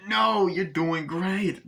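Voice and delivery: slow, high drawn-out reassurance; imitating encouraging Chucky. Chucky to Glenn at the wheel, exaggerated encouragement.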